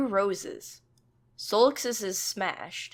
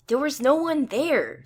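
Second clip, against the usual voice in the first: raised, emphatic insisting; insistent; 8 semitones higher, 6 dB louder than usual. A narrator's reading. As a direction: push back hard on every word. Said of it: "Sai insists again".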